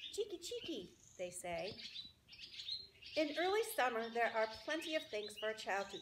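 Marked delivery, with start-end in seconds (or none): 0.1-0.9 high chirpy scold; sharp little birdcall; scolding swallows. Acted. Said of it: "the swallows swoop down from their nests and scold".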